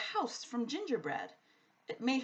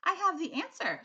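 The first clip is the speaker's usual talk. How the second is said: put-on little girl; 9 semitones higher, 4 dB louder than usual. A narrator's reading. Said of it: "Sophie said".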